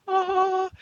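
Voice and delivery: high, held "ah" of sudden revelation; mock revelation "ah". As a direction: sing out a high, sustained "ah". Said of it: "then it was like".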